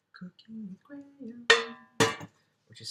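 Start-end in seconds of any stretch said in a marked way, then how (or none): 0.1-1.5 high breathy whisper. Shown as a demonstration. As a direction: whisper the name like a secret. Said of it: "whispered echo of the playlist name".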